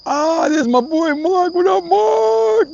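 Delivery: loud, high, drawn-out hollering of the name; excited shouted greeting. Real, not acted. Streamer greeting his boy Mark as he joins, excited holler. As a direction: whoop it out, stretching the words way up high.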